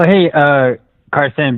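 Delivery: usual speaking voice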